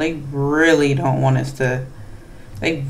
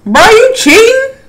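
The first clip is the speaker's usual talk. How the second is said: shocked; 7 dB louder than usual. Real, not acted. Shocked at killer's move.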